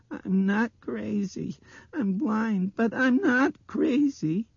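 put-on very high, loud, slow child's protest; trembling little girl. A narrator's reading. Blind girl defending herself to adults, trembling. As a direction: insist on it, high and upset. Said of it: "Her voice was trembling".

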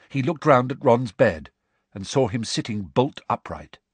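plain reading voice

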